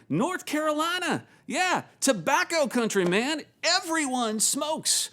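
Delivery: put-on high-pitched, exuberant North Carolina accent; hammed-up North Carolina twang, pitched high; Jason's North Carolina accent. Worship pastor boasting of tobacco country, high North Carolina accent. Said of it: "I'm trying to do Jason's accent".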